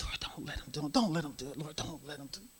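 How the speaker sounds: soft whispered plea to the Lord; whispered prayer. Retold or imitated. Recalling a private prayer, whispered pleading. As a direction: pray it under your breath.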